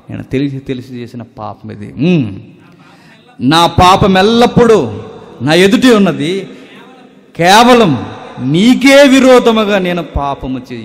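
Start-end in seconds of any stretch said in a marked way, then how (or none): 3.4-10.8 loud, ringing recital of the psalm; high, loud, impassioned scripture reading; preaching at full force. Acted. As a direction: proclaim it like a pulpit cry.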